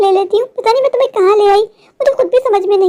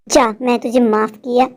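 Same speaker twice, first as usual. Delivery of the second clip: much lower, slower and softer, ghostly; deep witch voice; 8 semitones lower, 4 dB quieter than usual. Acted. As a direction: let her go in a low, slow spectral voice. Witch releases Priya. (धीमी, गहरी, भूतिया चुड़ैल की आवाज़)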